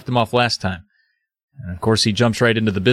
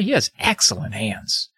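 creepy praise; 2 semitones lower, 4 dB quieter than usual. Retold or imitated. Creepy teacher repeats praise.

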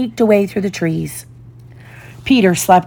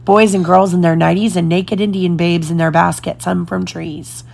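usual speaking voice

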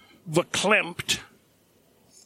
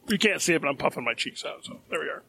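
ordinary talk, nothing marked

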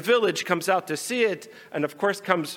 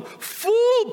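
high angry blurt of fool; 3 dB louder than usual. Acted. Pinchas angrily cutting off Moti, high outburst. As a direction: spit out fool. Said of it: "blotted out Pinchas angrily".

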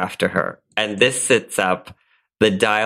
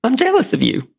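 Blanche Devereaux; 2 semitones higher than usual. Acted. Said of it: "In the role of Blanche Devereaux".

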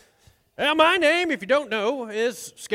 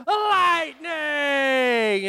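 called Lightning; 4 semitones higher than usual. Shown as a demonstration. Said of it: "we went".

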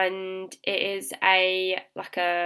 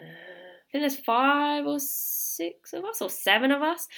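high-pitched, excited fumbling for the count; giddy, unsure counting up; 7 semitones higher than usual. Real, not acted. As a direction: pitch climbing with real excitement. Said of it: "I'm just so excited".

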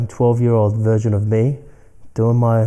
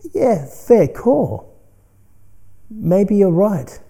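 much higher, slow, humouring agreement; mock concession; 8 semitones higher, much slower than usual. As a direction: indulge the voice with a playful shrug. Conceding to the ego voice.

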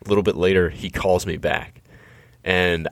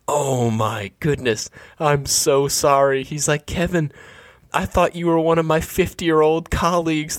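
shocked disbelief; 10 semitones higher than usual. Retold or imitated. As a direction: voice shoots much higher in stunned disbelief. Bob learns caller is twenty-two.

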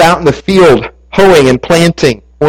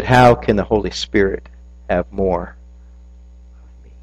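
softer and lower, fading to a hush on of me; the real question; 8 semitones lower, 8 dB quieter than usual. Shown as a demonstration. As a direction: let the true question sink in quietly.

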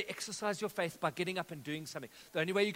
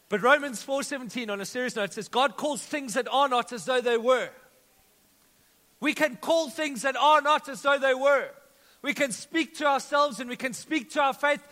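loud, higher, emphatic preaching; full-force preaching; 5 semitones higher, 10 dB louder than usual. Real, not acted. Pastor proclaims scripture to his congregation, preaching loudly. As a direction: proclaim it to the whole church.

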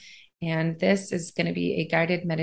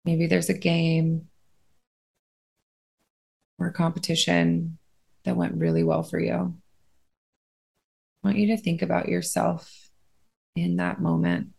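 unhurried, steady phrases, spaced out to let them breathe; guided meditation. Acted.

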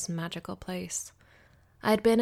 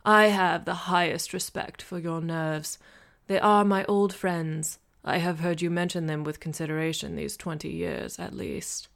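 put-on old husband; 5 dB louder than usual. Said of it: "his voice low and filled with the rumble of age".

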